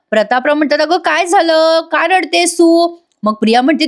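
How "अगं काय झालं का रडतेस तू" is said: high, slow, worried questions; acted concern. Prataprao asks why Priya cries, acted concern. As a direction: imitate an alarmed elder, drawing the words out.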